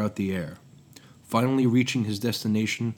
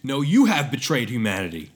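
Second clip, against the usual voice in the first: louder defiant reply; shouted retort; 3 semitones higher, 5 dB louder than usual. Acted. Prometheus answering his old master, shouted retort. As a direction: throw it back over the shoulder. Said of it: "shouted Prometheus".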